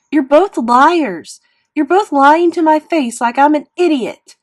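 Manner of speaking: high, very loud furious outburst; screaming. A narrator's reading. Boy accusing mother and sister, screaming. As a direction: scream at both in fury. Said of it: "I screamed at Via".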